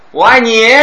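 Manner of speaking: 我爱你 with 你 punched high and loud; 我爱你, stressing 你 high and loud. Shown as a demonstration. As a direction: hit the last word 你 in 我爱你. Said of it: "他的重音落到哪里了".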